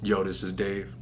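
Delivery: low, soft, smooth greeting; deep quiet lady-killer message; cool voice. Shown as a demonstration. Answering machine greeting, low soft cool voice. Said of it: "I can't even make the voice".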